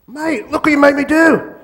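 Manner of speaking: high, loud angry complaint; hammed-up blokey outrage; aggrieved mate. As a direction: shout the blame at your mate. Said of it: "So the mate gets aggressive".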